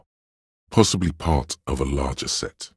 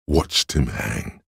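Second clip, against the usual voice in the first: low eerie inner voice; 5 semitones lower, slower than usual. Acted. Inner voice imagining the hanging.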